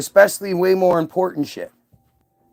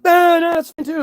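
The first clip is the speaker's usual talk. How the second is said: mock approval; 9 semitones higher than usual. Mocking the establishment voice again.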